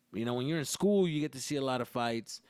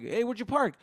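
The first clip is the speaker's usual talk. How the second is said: host asking; 9 semitones higher, 6 dB louder than usual. Retold or imitated. Host asks about parking.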